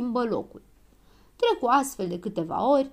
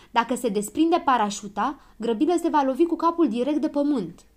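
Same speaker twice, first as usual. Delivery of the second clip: raised, urgent warning; higher, louder storybook alarm; shouted warning; 5 semitones higher, 5 dB louder than usual. A narrator's reading. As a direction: warn everyone of the danger. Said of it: "strigă doctorul Pilulă".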